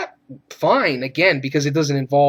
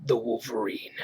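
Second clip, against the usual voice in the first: a lightly voiced low growl, much softer; low Wolverine growl; 5 semitones lower, 11 dB quieter than usual. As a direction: mutter the name like a hint of a gruff hero. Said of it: "I like this line that Wolverine says".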